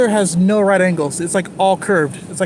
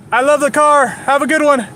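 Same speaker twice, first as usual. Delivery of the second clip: loud, much higher shouted compliment; calling out; 8 semitones higher, 7 dB louder than usual. Real, not acted. Complimenting a passing driver, loud calling out. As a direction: yell it across to the driver.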